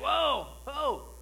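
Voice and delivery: high, excited exclamation; excited whoa ho. Shown as a demonstration. Preacher contrasting modern excited whoa. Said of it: "a word of excitement".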